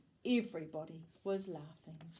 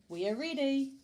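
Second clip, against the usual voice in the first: higher, louder announcement; calling out; 5 semitones higher, 5 dB louder than usual. A narrator's reading. Amelia Bedelia calls to the waiting ladies, calling out. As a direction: call out that you're ready. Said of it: "called Amelia Bedelia".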